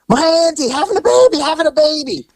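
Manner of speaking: put-on high, urgent young girl voice; frantic girl. Frantic girl at the door to the officers, high and urgent. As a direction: mimic the panicked girl at the door. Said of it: "And she goes".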